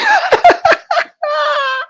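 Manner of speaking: high squealing burst of laughter; real high laugh; shrieking laugh. Real, not acted. High laugh after apology.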